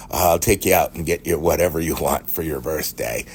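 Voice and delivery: usual speaking voice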